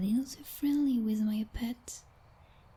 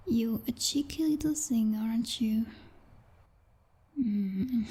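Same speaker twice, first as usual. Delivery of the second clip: ordinary delivery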